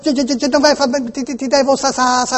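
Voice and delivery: panicked repeated false starts; scared stutter. Pretend. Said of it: "non riesco più a pa-, parlare".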